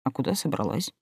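ordinary delivery

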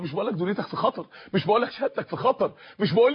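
low, quick and understated; quick dismissal. Real, not acted. Sets worldly danger against religion. (نفي سريع بنبرة منخفضة)

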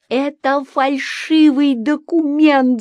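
slow, high-pitched protest; crying out. A narrator's reading. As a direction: cry out the accusation, stretching the words. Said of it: "закричала я". (кричит, возмущённо)

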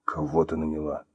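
low, hushed demand. Acted. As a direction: drop the voice low and soft. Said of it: "понизив голос".

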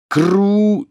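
very high, loud burst; Harry's shouted curse. A narrator's reading. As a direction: hurl the curse in a high yell. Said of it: "снова выкрикнул Гарри". (высокий громкий выкрик заклятия)

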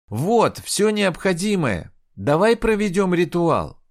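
loud, high and slow. A narrator's reading. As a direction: speak up so he hears. Girl calls to the blind shaman.

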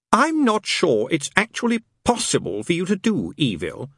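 mock the angel's own words back at him; sarcastic demon. Demon throwing the angel's words back, sarcastic. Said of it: "said Crawley sarcastically".

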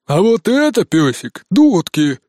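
loud grunted piglet retort; grunting piglet. A narrator's reading. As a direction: grunt it like a cocky pig. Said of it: "хрюкнул Жорик".

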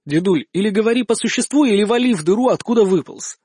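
plain reading voice

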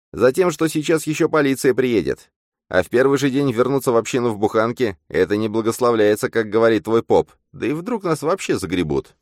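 high, loud, urgent sidekick voice; high-pitched pushy character; agitated double. Acted.

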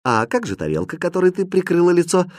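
sly, teasing question; lightly mischievous probing. A narrator's reading. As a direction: ask it slyly, catching someone out. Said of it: "лукаво спросил Хрустальд".

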